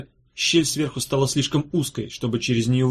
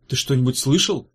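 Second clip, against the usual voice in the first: ordinary delivery, nothing marked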